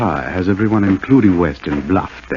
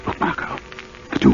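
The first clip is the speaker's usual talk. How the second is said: whispering; 7 semitones lower, 5 dB quieter than usual. Acted. Hiding in cemetery, whispering.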